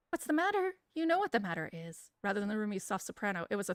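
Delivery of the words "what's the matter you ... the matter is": higher first take of the father's line; first try, pitched up. Acted. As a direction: start the line up high. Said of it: "threatening masculine voice".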